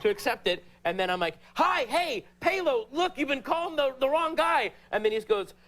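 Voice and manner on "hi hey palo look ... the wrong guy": high-pitched, eager acted explanation; urgent explaining. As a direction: get through to him before he hangs up. Reenacts urgently talking to caller.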